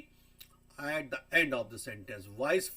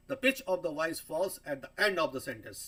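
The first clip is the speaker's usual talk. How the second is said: slow, careful reading of a definition; slow lecture read; slow reading aloud; 2 semitones higher, 5 dB louder, much slower than usual. Shown as a demonstration. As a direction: read it out slowly like a textbook. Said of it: "sentence के एंड में हमारी pitch fall करती".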